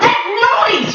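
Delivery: high shouted parrot command. Acted. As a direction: yell to rouse everyone. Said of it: "he shouted".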